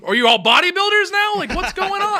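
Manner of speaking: exasperated mock rant.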